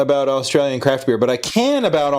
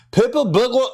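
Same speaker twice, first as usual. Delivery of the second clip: high, strained Scottish attempt at "purple burglar"; mock Scottish struggle; 12 semitones higher than usual. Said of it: "They can't say it".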